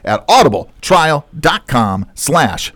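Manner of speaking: upbeat podcast opener, starting high and settling; show-host intro. Acted. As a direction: read the intro and sponsor plug like a radio host, drawing out the web address. Hosts the show intro and sponsor read.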